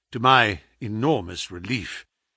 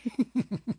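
soft high snigger; 9 semitones higher, 7 dB quieter than usual. A narrator's reading. Visitor hears himself flattered, sniggering. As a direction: snigger quietly at the sick man. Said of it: "Our visitor sniggered".

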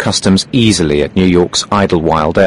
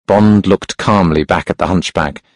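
plain reading voice

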